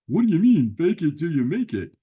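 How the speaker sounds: a loud, baffled puppet buddy; Sammy puzzled. Acted. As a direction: butt in, confused. Sammy questions host.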